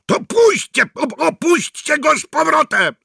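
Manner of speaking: panicked high, loud yell. Acted. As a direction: scream at them to drop it. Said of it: "wrzeszczał Mikke".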